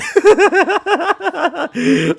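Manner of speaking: high burst of laughter; high, loud real laughter. Real, not acted. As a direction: crack up laughing. Laughing at own book.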